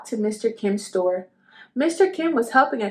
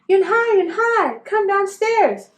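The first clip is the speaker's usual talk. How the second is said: high, loud call up the stairs; 10 semitones higher, 4 dB louder than usual. Acted. Mother calling her daughter downstairs, high and loud. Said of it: "Mother called up to Yun Hai".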